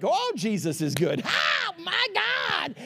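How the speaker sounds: high, drawn-out mock exclamation; hammed-up amazement. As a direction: burst out as if floored by a miracle. Acted amazement at a miracle.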